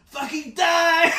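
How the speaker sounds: put-on shouted in a thick French accent. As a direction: yell it in an over-the-top French accent. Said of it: "in the biggest French accent I've ever heard".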